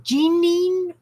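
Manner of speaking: "Jeanine" with a long ee first, drawn out; Jeanine pronunciation. Shown as a demonstration. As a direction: say "Jeanine" as jee-NEEN, questioning. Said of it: "Am I pronouncing it correctly".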